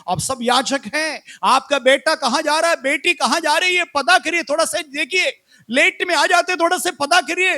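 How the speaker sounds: high, loud exhortation building in pitch; forceful preaching. Real, not acted. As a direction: press the congregation urgently at full voice.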